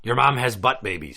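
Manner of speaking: ordinary talk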